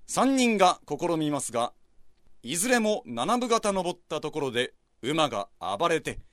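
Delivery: ordinary talk, nothing marked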